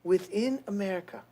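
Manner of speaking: put-on soft Slavic-accented First Lady reading; quiet put-on accent of a First Lady; Melania accent. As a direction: keep up the soft Eastern European accent. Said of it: "That's close, that's close".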